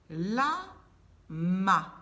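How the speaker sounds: "La ma" punched loud, each syllable separate; listen carefully. Shown as a demonstration. Teacher telling children to listen, loud and separated. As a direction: hammer out "La" then "ma". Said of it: "écoutez bien".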